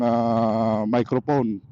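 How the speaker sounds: ordinary talk, nothing marked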